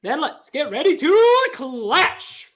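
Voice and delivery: slow, booming ring announcer call; boxing announcer. Acted. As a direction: stretch it out like a fight announcer. Announcer hype line, boxing style.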